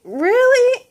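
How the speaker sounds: can't believe it, squeaky; stunned disbelief. Retold or imitated. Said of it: "I was like".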